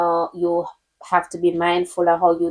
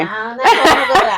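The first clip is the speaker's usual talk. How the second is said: laughing echo; 5 semitones higher, 5 dB louder, faster than usual. Retold or imitated. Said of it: "That's funny".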